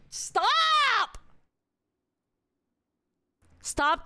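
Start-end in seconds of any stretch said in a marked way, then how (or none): 0.1-1.2 high shriek. Real, not acted. Yelling at chat.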